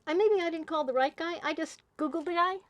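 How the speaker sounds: usual speaking voice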